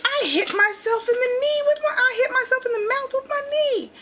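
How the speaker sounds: put-on high, cheerful child explaining; a kid telling mom what happened, unbothered; gently voiced giggly child; little girl voice. Child explains her injury.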